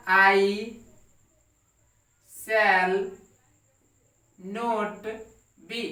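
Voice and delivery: each word set apart by long pauses; slow word-by-word dictation. Shown as a demonstration. Teacher dictating to the class, word by word, slowly. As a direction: dictate it one word at a time for students to copy. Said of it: "teacher dictating word by word".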